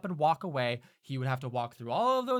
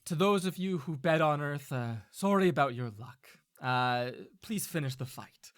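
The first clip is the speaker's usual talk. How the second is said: elven man; 2 semitones higher, 8 dB louder than usual. Acted. Elven man addresses the bettors at a fight, composed announcement. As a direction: announce the result to a crowd, then ease down. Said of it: "that elven man who lifts his hand up".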